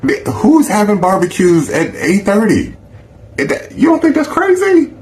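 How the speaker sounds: can't believe what he's hearing; high-pitched outrage at the invite; incredulous. Real, not acted. Ranting about late barbecue.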